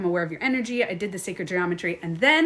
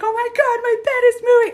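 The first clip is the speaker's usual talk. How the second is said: much higher, louder frightened cry; mock panic; 14 semitones higher, 8 dB louder, faster than usual. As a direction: relive the bed-shaking fright. Retelling her panic.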